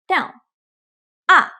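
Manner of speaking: "ah" dropping straight down; falling tone. Shown as a demonstration. Teacher models the falling fourth tone. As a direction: let "ah" fall fast. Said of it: "it goes straight down".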